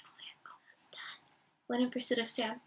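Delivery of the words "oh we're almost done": whispered aside. Real, not acted.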